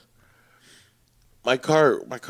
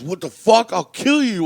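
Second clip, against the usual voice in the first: raised, higher-pitched threat; mock furious outburst, louder; retold angry threat; 5 semitones higher, 7 dB louder than usual. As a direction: flare up like an affronted mugger. Retold kid threatening the father.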